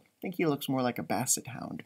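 ordinary delivery

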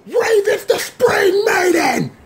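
high, drawn-out cry of disbelief; shocked shout. Real, not acted. Reacting to the Raven reveal, shocked high shout. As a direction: yell the reveal like you can't believe it. Stunned by a twist in the show.

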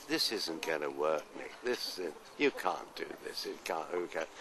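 anxious, hushed protest; worried quiet aside. Retold or imitated. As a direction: murmur doubts to a friend, half under the breath. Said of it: "I said".

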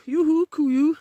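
ordinary talk

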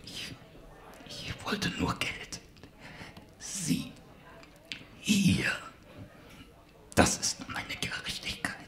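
fading, faint slow whisper; growing weaker. Acted. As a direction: let the voice die away. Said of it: "Seine Stimme wurde schwächer".

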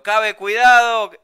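high, loud drawn-out warning shout. Retold or imitated. Swimmer behind warning friend of sharks, shouted warning. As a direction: shout it out, stretching the words. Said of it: "Y le grito".

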